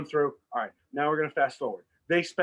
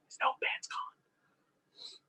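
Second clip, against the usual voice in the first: slow, hushed whisper of alarm; mock whisper; 8 dB quieter, slower than usual. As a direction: whisper it like a dreadful secret. [name] panics over missing notepad.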